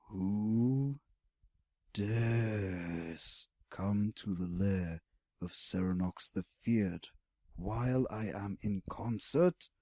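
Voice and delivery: dragon boom. A narrator's reading. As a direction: draw it out like a mountain speaking.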